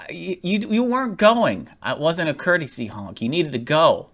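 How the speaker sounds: high-pitched, imitated indignant protest; indignant retort. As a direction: mimic an exasperated driver talking back. Voices the driver's indignant retort.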